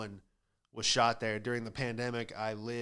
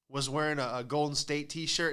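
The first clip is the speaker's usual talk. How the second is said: ordinary delivery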